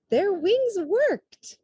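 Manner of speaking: high, loud, slow amazement; awed exclamation; amazed. A narrator's reading. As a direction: marvel at the birds flying. Amazed at the birds flying.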